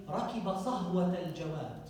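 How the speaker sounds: ركب صهوة الجواد spoken quickly in Arabic; quick Arabic quote, ركب صهوة الجواد; Arabic phrase. Shown as a demonstration. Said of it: "we say".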